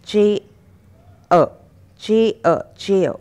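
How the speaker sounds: चे and अ split, then joined into चेयर with no r; teacher drilling चेयर in parts; syllable by syllable. Shown as a demonstration. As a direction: break चेयर into "chay" and "uh", then blend them. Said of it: "आप कहेंगे चेयर".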